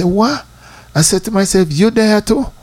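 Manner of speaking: high, startled disbelief; astonished to find a friend also crying at the altar; surprised. Retold or imitated. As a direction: pitch it up as if caught off guard. Sees his friend crying beside him.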